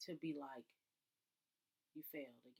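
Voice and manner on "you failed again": lower, much softer flat verdict; inspector's verdict. Pretend. Inspector telling her the car failed again, low soft verdict. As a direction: deliver the bad news quietly.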